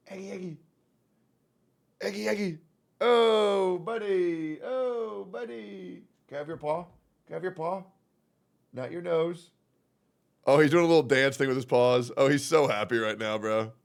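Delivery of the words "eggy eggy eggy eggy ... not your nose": soft, high cooing; coaxing pet talk. Real, not acted. Owner asking cat for its paw, soft cooing. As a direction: coax a cat gently and sweetly.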